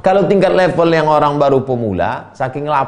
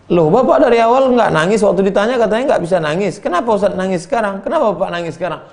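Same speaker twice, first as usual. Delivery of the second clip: high, rapid, surprised questioning; acted bewilderment; 9 semitones higher, 4 dB louder, faster than usual. Pilgrims ask the man why he cries now, acted bewilderment. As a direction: fire off puzzled questions in a raised voice.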